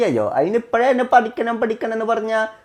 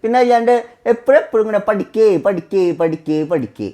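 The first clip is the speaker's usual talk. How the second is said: ordinary delivery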